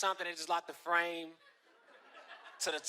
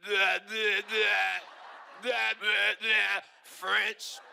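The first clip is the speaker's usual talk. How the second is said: louder mock French babble; 5 dB louder, much slower than usual. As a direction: imitate French cop as blah blah. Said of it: "speaking in French".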